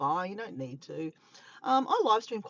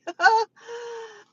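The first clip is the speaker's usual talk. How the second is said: sudden loud high laugh; real burst of laughter; 14 semitones higher, 10 dB louder than usual. Real, not acted. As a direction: crack up out loud at the chat. Laughs reading the chat.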